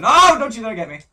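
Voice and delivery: shrieking high and loud; real panic; panicked scream. Real, not acted. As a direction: scream in panic as he's caught. Panicked as enemy attacks.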